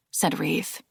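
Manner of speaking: ordinary delivery, nothing marked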